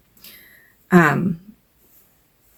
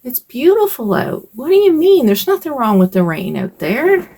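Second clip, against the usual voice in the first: higher, cheerful fatherly coaxing; a lightly voiced upbeat dad urging her to enjoy the rain; imitating dad; 7 semitones higher than usual. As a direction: son recalling dad cheerily coaxing grandma. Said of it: "he would say to her".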